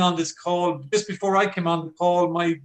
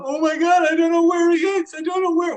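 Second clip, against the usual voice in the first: put-on much higher and louder, breathless fretting old woman; frantic old lady; 14 semitones higher, 7 dB louder than usual. Old lady searching for her lost dog, high and frantic. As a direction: squeak it out like a panicked granny. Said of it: "there was this old lady going".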